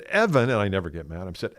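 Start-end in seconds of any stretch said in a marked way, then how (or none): 0.0-0.5 high, raised voice calling Evan; a grandpa calling out his grandson sharply; stern call. Retold or imitated. Grandpa calls his grandson sharply after the car drops, high and raised.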